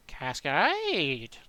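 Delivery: "Cascade" going up then falling away; rise-fall tune. Shown as a demonstration. Trying the other way, Cascade rising then falling. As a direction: lift "Cascade" then let it drop. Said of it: "a rising, then falling inclination".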